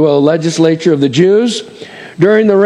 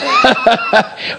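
a short high burst of real laughter. Real, not acted. Pastor laughing about people shutting down. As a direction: laugh it off lightly.

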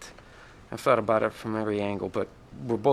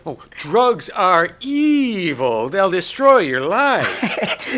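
slow, preachy lecturing father; lecturing dad; 8 semitones higher, 7 dB louder, much slower than usual. Retold or imitated. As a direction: drag it out like a stern dad. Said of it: "He lectured us for an hour".

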